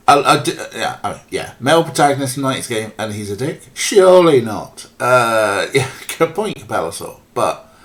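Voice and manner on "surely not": loud slow dry sarcasm; mock disbelief, said slowly; sarcastic. Real, not acted. Sarcastic reply about protagonist.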